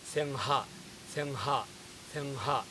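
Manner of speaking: "singha" with an ng as in sing, then ha; correct velar nasal. Shown as a demonstration. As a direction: say "singha" dropping the g right after ng. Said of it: "So I get singha".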